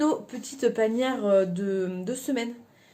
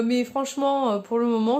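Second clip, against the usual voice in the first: normal talking voice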